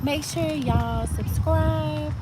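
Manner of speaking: slow, high drawn-out plea. Acted. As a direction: stretch it out slowly.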